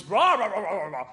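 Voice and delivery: mock upper-class braying; imitated posh-dad noise. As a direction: roar out a wordless English gentleman's rah-rah. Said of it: "Occasionally makes the noise".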